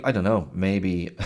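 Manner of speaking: normal talking voice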